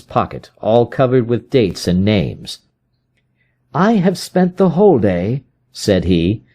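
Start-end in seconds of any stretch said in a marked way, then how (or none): 3.7-5.4 higher, brisk detective voice; Holmes, explaining his search. Acted. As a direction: lay out the trail.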